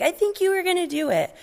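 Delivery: high, fast accusing father voice; suspicious dad. Retold or imitated. Marlin accusing Nemo, high fast suspicious dad. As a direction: pin the kid down in a squeaky voice. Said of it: "And he's like".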